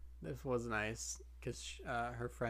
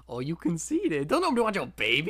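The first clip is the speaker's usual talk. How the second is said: high, louder sassy put-down; mock sassy retort; 5 semitones higher, 10 dB louder than usual. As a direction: snap back with exaggerated attitude. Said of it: "it's like".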